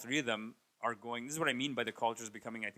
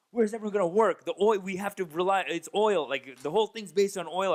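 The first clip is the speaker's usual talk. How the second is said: louder mock rant; mock yelling; 5 semitones higher, 6 dB louder than usual. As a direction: keep the mock outrage going. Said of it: "yelling at me".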